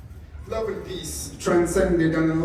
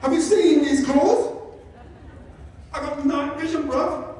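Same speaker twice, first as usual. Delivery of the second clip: higher street London voice for the cat; cocky London geezer cat; sassy East London cat talking back to owner; 7 semitones higher than usual. Retold or imitated. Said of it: "That's how my cat talks".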